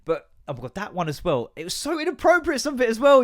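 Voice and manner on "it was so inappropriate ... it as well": much higher and louder in disbelief; exasperated outrage. Real, not acted. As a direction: pitch it up high, appalled. Outraged retelling of inappropriate video.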